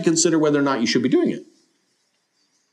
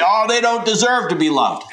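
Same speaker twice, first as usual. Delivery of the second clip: mock scoffing objector, pitched much higher; dismissive objection; 10 semitones higher than usual. Preacher mimicking a listener who scorns outsiders, mock scoffing. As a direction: toss it off like a scornful churchgoer.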